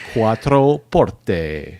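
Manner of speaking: Quattro Porte drawn out slowly, "kwah-tro por-teh"; slow Italian-styled Quattro Porte; Italian pronunciation, slow. Shown as a demonstration. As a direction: linger on each syllable of Quattro Porte. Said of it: "all that really means is Maserati four-door".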